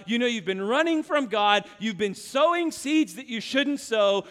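ordinary talk

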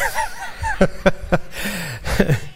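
quiet breathy chuckle; soft laugh. Real, not acted. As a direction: laugh it off softly. Laughs after breathless preaching.

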